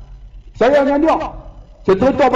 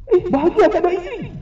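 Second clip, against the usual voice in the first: high-pitched mock astonishment; acted disbelief, voice leaping right up; incredulous retort; faster than usual. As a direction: question it in a squeaky, disbelieving voice. Astonished retort to a widower's claim.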